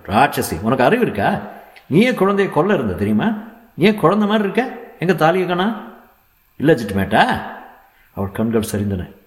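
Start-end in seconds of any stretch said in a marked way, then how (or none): 0.1-7.4 high, heated doctor's rebuke; irate scolding doctor. A narrator's reading. Doctor berating a young mother, angry high scolding. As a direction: fire accusing questions in a raised pitch.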